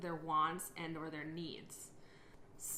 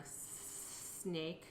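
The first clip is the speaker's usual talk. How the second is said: stuttered s; 6 dB quieter than usual. Shown as a demonstration. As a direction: sound repetition on snake. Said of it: "If I was going to say the word snake".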